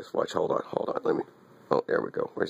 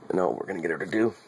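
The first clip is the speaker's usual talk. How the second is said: ordinary talk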